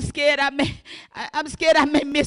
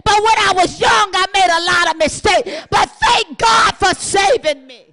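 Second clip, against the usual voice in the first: loud shouted testimony, huffing laughs between lines; full-force preaching with laughs; 3 semitones higher, 9 dB louder than usual. Acted. Preacher confessing her past sins to the church, shouted with laughter.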